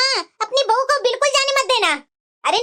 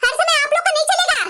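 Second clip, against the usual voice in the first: higher-pitched, indignant outburst; angry defiance; 4 semitones higher than usual. Acted. Daughter-in-law refusing mother-in-law's ban, angry defiance. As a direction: stand up to her, pitch raised. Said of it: "मैं गुस्सा होकर के बोली".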